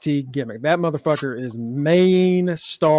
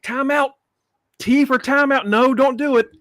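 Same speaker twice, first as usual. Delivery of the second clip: higher urgent mock protest; mock timeout call; 7 semitones higher than usual. Sean Spears stopping Tatum, mock urgent. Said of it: "Even Sean Spears like".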